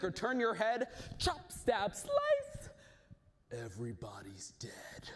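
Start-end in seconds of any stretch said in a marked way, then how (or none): 1.9-2.7 much higher pitched slice; sudden squeak. Acted. Rhythmic fight. 3.5-5.1 hushed whisper. Acted. Story ends in deaths.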